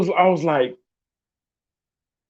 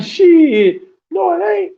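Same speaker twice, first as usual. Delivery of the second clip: higher, mock-proud friend voice; clueless buddy; 10 semitones higher, 5 dB louder, slower than usual. Imitating the friend justifying the pizza date, higher put-on voice. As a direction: ham up the oblivious friend defending himself. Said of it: "Be like".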